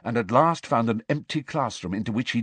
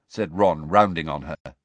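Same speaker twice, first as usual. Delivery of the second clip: ordinary delivery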